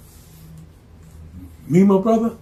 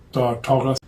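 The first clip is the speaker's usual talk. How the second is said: ordinary delivery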